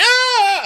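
loud high-pitched shriek; comic startled scream; mock scream. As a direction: shriek in hammed-up panic. Acts freezing at thrown ball.

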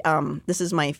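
normal talking voice

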